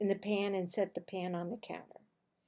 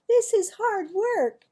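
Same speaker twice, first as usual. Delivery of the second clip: put-on higher, louder boy's complaint; complaining boy; 9 semitones higher, 8 dB louder than usual.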